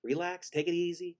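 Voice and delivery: high, eager calming-down voice; mock calming. Teller calming his friend about the buffet, mock excited. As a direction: soothe him with exaggerated urgency. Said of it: "I was like".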